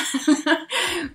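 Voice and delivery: a bright burst of laughter, then it falls away; loud amused laugh. Real, not acted. A creator amused at talking alone to her computer, bright laugh. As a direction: laugh freely at how odd this feels.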